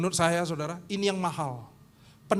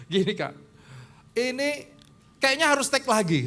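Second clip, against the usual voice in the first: put-on 1 hesitant young musician; 5 semitones higher, 3 dB louder than usual.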